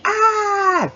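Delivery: very high yelp as the stomach is pressed; sharp cry of pain. Retold or imitated. Patient yelping when the doctor presses her stomach. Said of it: "I was like".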